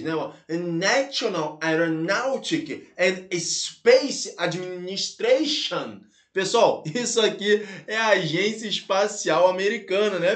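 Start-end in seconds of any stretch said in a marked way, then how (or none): 0.5-6.0 slow, careful English with a Brazilian Portuguese accent. Shown as a demonstration. Teacher attempting the English name for students, slow Brazilian-accented English. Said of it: "eu não vou querer aqui pronunciar o inglês".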